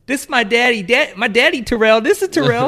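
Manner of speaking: put-on very high, loud child voice; hammed-up little boy shouting proudly. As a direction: squeal it like an excited small kid. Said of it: "And the boy goes".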